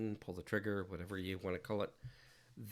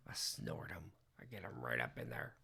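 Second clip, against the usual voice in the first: joking whisper; 4 semitones lower, 3 dB quieter than usual. Acted. Streamer jokingly whispers to chat about snorting pixels. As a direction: whisper "I get 'em right up in there" low and breathless.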